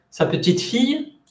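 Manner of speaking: "Sa petite fille" joined, "fille" rising high; stress on fille. Shown as a demonstration. Teacher showing students granddaughter, weight and high rise on "fille". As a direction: pass lightly over "petite", lift "fille". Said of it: "la entonación más importante está en fille".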